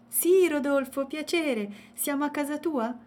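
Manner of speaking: put-on a child politely meeting a bear. A narrator's reading. Child character voice. (voce di bambina appena accennata)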